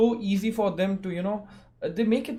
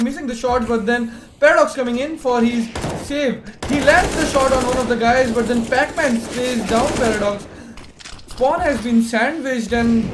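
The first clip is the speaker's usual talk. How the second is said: loud, higher hype play-by-play; esports caster; 6 semitones higher, 8 dB louder than usual. Real, not acted. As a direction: call the fight like it's the final round. Rapid play-by-play of fight.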